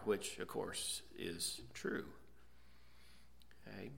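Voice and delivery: soft, breathy throwaway aside; whispered aside. Real, not acted. Preacher adding a quiet aside to the congregation, whispered. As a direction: drop to a whisper for the afterthought.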